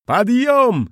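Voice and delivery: high, loud barked command. Acted. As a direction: order them up at once. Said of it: "скомандовала Вжих".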